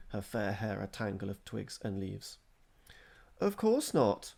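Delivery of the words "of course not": innocent. A narrator's reading. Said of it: "replied B E innocently".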